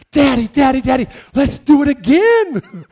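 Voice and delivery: put-on high, loud, thrilled kid voice; delighted child. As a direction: squeal it like a kid begging for another go. Child delighted after being caught.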